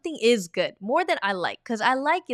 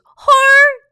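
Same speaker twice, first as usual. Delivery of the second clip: 헐 blurted high and loud in shock; a startled, squealed 헐; shocked gasp; 17 dB louder than usual. Shown as a demonstration. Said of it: "헐" is not an actual word. It's like a sound".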